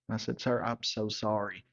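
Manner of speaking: usual speaking voice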